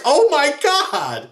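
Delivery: high, slow, stunned oh my God; slow shocked high exclamation; horrified gasp. Retold or imitated. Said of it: "She's like".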